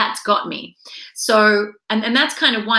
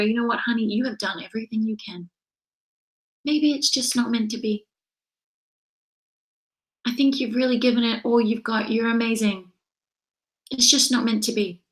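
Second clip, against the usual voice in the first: softer, gentle let-down; gentle consoling wife; 4 dB quieter than usual. Retold or imitated. Wife consoling husband after rejections: soft, gentle. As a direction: ease him down softly, kindly.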